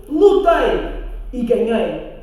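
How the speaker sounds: loud, slow bragging; boastful prince. Acted. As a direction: puff up like a proud hero. (príncipe vaidoso)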